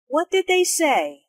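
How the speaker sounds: "say" emphasized with pitch falling at its end; what-question falling on "say"; emphasis on SAY, falling. Shown as a demonstration. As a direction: stress "say", then let it drop. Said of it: "Notice how say is emphasized".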